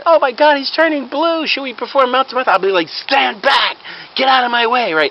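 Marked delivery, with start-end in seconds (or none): 0.0-2.5 panicked mock screaming. Crowd watching the man turn blue, mock screaming panic. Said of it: "yelling and screaming". 2.9-4.9 high, urgent shouted order; commanding mock shout. Fake doctor pushing through panicking bystanders, mock commanding shout.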